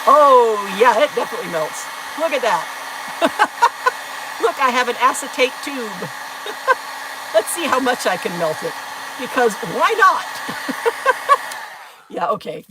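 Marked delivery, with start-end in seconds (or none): 2.7-4.4 giggle. Real, not acted. Laughing at melting acetate. 6.1-7.3 giggle. Real, not acted. Laughing at melted tube. 10.3-12.0 giggle. Real, not acted. Laughing after "why not.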